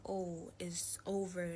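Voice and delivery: ordinary delivery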